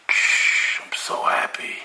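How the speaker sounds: breathy hushed whisper. Acted. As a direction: whisper it with forced joy. Husband feigns joy for neighbor.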